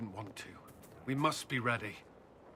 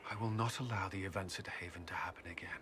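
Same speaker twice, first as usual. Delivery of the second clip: fast, breathless whisper of a promise; a rushed, hushed promise; whispered vow; 3 semitones lower, 5 dB quieter, faster than usual. Acted. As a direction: hush it out quickly, like a secret oath. Vow after Haven, whispered.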